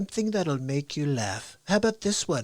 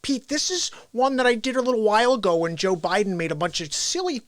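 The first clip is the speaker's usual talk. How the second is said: Bill Gates; 4 semitones higher than usual. Acted. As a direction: introduce the clip in a high, stretched parody voice. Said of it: "I'm doing Bill Gates right now".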